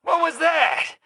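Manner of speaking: high, startled yelp of alarm; jolted, high and sharp; startled shout. Acted. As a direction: yelp it as something slams into you. Creature bowls him over.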